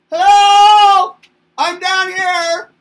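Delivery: put-on high, loud little-kid call; a small kid yelling up at the tall people, high and loud; shouting up. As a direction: shout up from way down below. Toddler calling up again.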